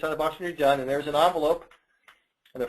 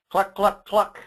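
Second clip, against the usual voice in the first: loud higher clucking; hen clucks; 5 semitones higher, 6 dB louder, slower than usual. Acted. Parent reading the hen page, loud higher clucks. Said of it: "Natter the hens".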